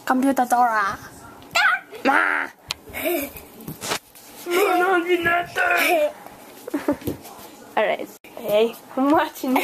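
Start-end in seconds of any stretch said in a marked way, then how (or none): none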